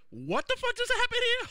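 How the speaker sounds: high, loud shocked outburst; stunned disbelief. Retold or imitated. Rewinding the show, shocked by the reveal. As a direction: blurt it out in total disbelief. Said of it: "'cause I said".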